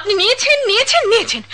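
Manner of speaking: high loud repeated accusation; insistent accusation. Acted. Woman accuses the drunk son of taking her bag, insistent and loud. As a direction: hammer each word at him.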